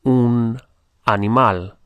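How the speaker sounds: "animal" said the Spanish way, stress on the last syllable; Spanish pronunciation. Shown as a demonstration. Teacher models words for learners to notice, Spanish pronunciation. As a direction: say "animal" as a Spanish word, slowly and deliberately. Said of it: "pay attention to the pronunciation".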